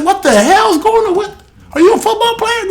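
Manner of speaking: high-pitched, incredulous coach impression; imitated coach scolding. Preacher imitating a football coach scolding a player, incredulous and high.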